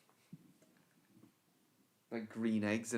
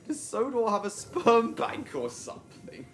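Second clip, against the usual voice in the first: excited loud laughing question; 10 semitones higher, 5 dB louder than usual. Real, not acted.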